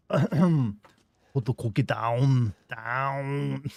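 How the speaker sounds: very slow, drawn-out Austrian Arnold impression; exaggerated Austrian accent, deliberate. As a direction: stretch every word like Arnold. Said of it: "out of practice with my Arnold".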